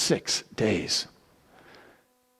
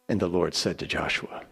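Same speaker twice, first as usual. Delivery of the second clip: usual speaking voice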